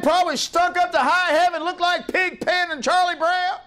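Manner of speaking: high, driving preacher's chant; preaching at full force. Real, not acted. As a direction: preach it hard over the congregation.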